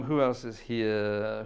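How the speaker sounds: slow, put-on musing voice; drawn-out musing. Host musing to his chat, in a slow drawn voice. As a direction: stretch the words out in a voice.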